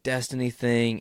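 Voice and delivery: usual speaking voice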